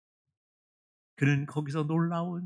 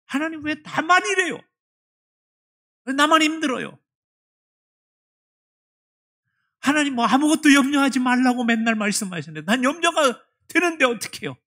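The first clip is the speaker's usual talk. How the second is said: high, loud protesting cry; complaining to God; 10 semitones higher, 7 dB louder than usual. Retold or imitated. Deaconess arguing with God, high and loud complaint. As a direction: demand an answer from God. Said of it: "따지고 싶었어요".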